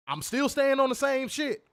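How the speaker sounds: high, loud laughing dare; defiant challenge. Real, not acted. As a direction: taunt them to bring it.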